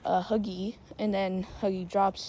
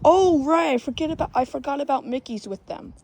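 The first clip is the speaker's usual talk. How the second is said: sudden realization; 6 semitones higher, 5 dB louder, faster than usual. Real, not acted. Sudden remembering.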